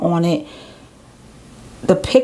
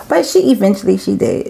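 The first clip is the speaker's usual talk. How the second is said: whispered laugh. Real, not acted. Laughing aside after joke.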